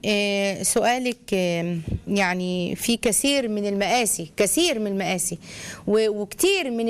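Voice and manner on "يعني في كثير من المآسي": ordinary talk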